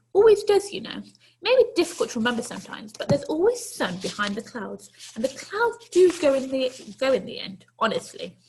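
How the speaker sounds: put-on a brisk granny inviting the boy in; kindly old lady. Old lady to the runaway boy, kindly coaxing.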